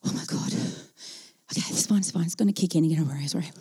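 low, soft murmur under the breath; hushed self-soothing. Retold or imitated. Stuck passenger to herself as elevator stops, hushed and nervous. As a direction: quietly talk yourself down from panic.

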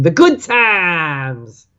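slow drawn-out mocking echo; imitating the host. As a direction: stretch "The good times" lazily like a parody. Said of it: "like Thomas would imitate me saying".

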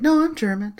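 high-pitched indignant comeback; mock protest. Co-host mimics bakery owner insisting, high-pitched. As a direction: jump high in a retold protest.

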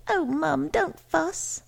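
high-voiced daughter's protest; chiding daughter. A narrator's reading. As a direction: chide your mother in a high, light voice. Daughter chides her mother.

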